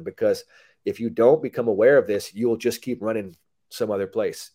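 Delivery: normal talking voice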